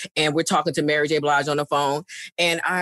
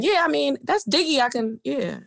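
higher, approving voice in her head; musing inner approval; 7 semitones higher than usual. Retold or imitated. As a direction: think it over aloud, pitched up. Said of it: "In my mind, I was going".

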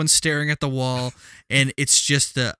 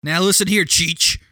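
hammed-up threatening tourist; comic tough-talking demand; mock tough tourist; 4 semitones higher, 4 dB louder than usual. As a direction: play at threatening a local, half laughing. Acted threat from pushy tourist.

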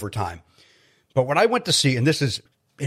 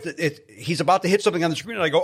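normal talking voice

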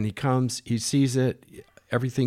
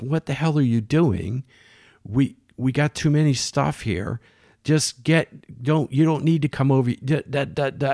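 raised, higher-pitched mock yelling; retold boss yelling; 5 semitones higher than usual. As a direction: act out a boss chewing someone out, half-voiced. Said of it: "starts yelling at".

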